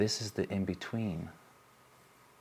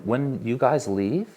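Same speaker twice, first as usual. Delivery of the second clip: ordinary delivery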